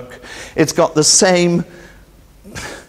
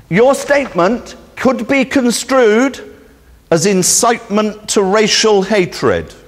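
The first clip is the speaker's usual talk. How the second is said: slow, higher, formal police warning; lightly mock the officer's official caution; officious caution; 6 semitones higher than usual. Detective cautioning caller over the phone, slow and officious.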